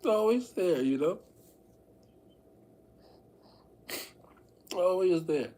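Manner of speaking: real crying, voice breaking. Real, not acted. Streamer breaks down crying to viewers about being alone. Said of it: "I don't know why I care so much".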